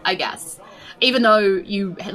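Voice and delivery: ordinary delivery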